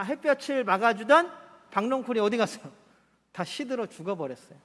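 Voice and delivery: ordinary talk, nothing marked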